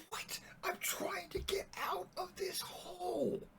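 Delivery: very high, slow, strained squeal of protest; hammed-up exasperated yell. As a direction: wail in mock outrage from the pit. Exasperated man yells after useless help.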